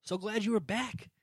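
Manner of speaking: high, drawn-out mock-excited cheer; slow, high hammed-up delight; exclamatory cheer. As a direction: read it like it ends in exclamation points. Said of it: "Exclamation points".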